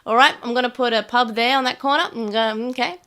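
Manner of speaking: pushy, hammed-up Aussie settler; put-on Australian accent. Australian settler telling locals it's his land, exaggerated Australian accent. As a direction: stake a claim in broad Australian. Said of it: "I really like playing Australians".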